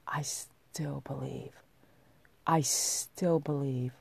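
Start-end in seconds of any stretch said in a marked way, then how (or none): 0.1-1.5 soft slow whisper. Shown as a demonstration. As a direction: breathe it out slowly. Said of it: "whispered then repeated".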